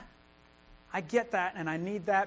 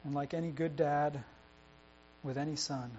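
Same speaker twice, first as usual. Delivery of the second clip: closing prayer; 2 semitones lower, 6 dB quieter than usual. Acted. Pastor prays for the community of believers, soft reverent. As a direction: pray over the congregation gently.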